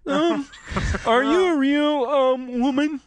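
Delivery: very high put-on voice; squeaky, drawn-out mock curiosity; game master role. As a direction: play the game master in hammed-up falsetto. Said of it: "I could be the G M".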